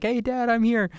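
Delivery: put-on high-pitched cute little child; cute kid voice. Dad imitating his app's cute reminder, cute kid voice. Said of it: "be like, cute".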